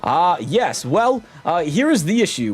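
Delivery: high, pompous ship captain voice, stretched vowels; pompous captain Flavio. Acted. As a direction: show off the ship like a vain aristocrat. Voices Flavio explaining problem.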